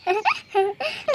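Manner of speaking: soft mocking giggle. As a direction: snicker at the brother's fall. Sister laughs at brother. (भाई पर दबी हँसी)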